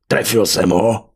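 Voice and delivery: slow, loud call into the distance; brutish warrior calling. A narrator's reading. Venátor calls into the dark after his throw, bellowing. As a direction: bellow the question out slowly.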